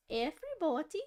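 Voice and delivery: a light British lady voice; British woman impression. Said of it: "some British woman goes".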